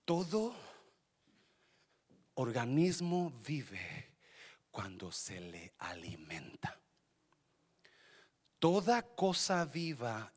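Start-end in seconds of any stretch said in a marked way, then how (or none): 3.5-6.8 softer, hushed whisper; whispered point. Acted. Pastor telling the church to write this down, whisper. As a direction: lower the voice to land the point. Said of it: "emphatic teaching point".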